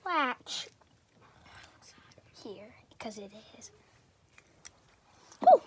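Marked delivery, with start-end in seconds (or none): 2.4-3.7 whisper. Real, not acted. Drops to whisper.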